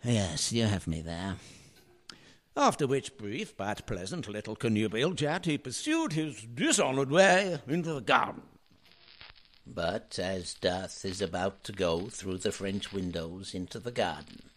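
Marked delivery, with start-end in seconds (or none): 2.6-14.5 low, measured narrator reading the directions; stage direction narration. A narrator's reading. Reader sets the scene between the lines, in an even narrator voice. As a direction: step outside the scene and read it calmly.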